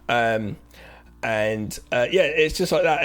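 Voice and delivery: ordinary talk, nothing marked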